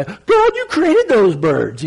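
retold awestruck cry to God; awed outburst. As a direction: exclaim in amazement at the pelicans. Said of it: "and I'm just like".

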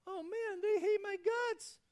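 hammed-up panic over a letter; panicked thought. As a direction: wail it like all hope is gone. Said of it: "you're thinking".

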